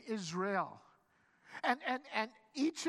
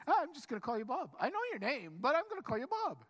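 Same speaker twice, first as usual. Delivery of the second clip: fast, high, comic insistence; breezy insistence; 6 semitones higher, 3 dB louder, faster than usual. Acted. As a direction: brush off the objection, quick and high-pitched. Acted breezy insistence on renaming.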